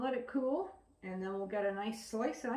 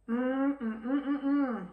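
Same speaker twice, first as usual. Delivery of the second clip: appreciative humming while tasting; savoring mm; 3 semitones higher, 4 dB louder, slower than usual. Real, not acted. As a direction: savour it, mm mm mm. Tasting the pumpkin bread.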